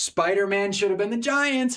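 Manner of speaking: "Spider-Man should have been the Giants" pitched well up and stretched on "Giants"; squeaky exaggerated repeat of the same gripe; mock high voice. Streamer repeating his Giants complaint, high and mocking. Repeats own take in put-on voice.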